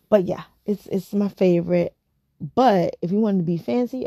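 ordinary talk, nothing marked

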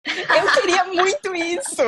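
muito mais aguda e rindo, animada; empolgada rindo. Real, not acted. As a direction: grita de alegria enquanto ri. (empolgada e rindo, bem aguda)

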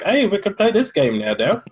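put-on Scottish lilt, badly done; terrible Scottish accent. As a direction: attempt a Scottish accent and miss. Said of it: "That's my terrible Scottish accent".

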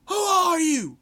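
high, loud imitated challenge; retold angry shout. Gunnery sergeant challenging a trainee, mock bellow.

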